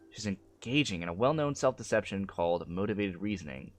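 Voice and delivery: usual speaking voice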